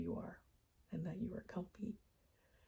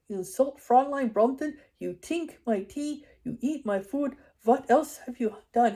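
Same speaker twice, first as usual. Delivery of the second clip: put-on loud, high ranting German accent; Herr Farnan's corny German accent; 6 semitones higher, 14 dB louder than usual. Dreamed vet accusing the intruder, corny German accent. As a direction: scold in a hammed-up German accent. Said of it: "such a completely corny accent".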